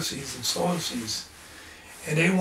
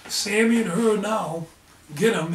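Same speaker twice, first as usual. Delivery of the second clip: ordinary talk, nothing marked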